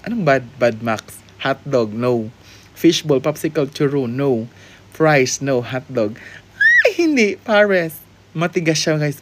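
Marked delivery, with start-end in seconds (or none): none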